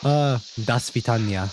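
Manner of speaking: slow, hammed-up fake Russian accent; mock Russian accent. Streamer teasing a soldier's voice for chat, mock Russian accent. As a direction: lay on a silly Russian accent, drawn out. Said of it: "Why does that guy have a Russian accent?".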